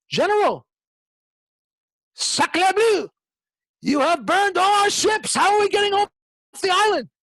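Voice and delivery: high, loud, alarmed cry with a French flavour; alarmed soldiers. Retold or imitated. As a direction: shout in dismay at the general. Panicked soldiers confront Napoleon.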